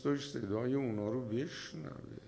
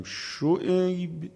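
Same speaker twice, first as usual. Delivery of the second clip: long drawn-out call, higher and louder; booming divine call of a name; 6 semitones higher, 6 dB louder, much slower than usual. Acted. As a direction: call his name slowly across a distance. God calls Shu'ayb by name.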